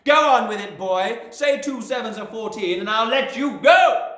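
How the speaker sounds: loud, high threats; threatening shout. A narrator's reading. Headmistress threatening a dangling boy, loud.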